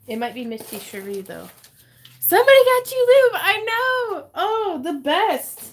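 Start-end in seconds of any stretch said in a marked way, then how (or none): 2.2-5.6 high, loud excited squeal; thrilled, squealing with delight. Real, not acted. Excited reaction to gift.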